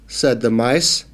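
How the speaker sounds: ordinary delivery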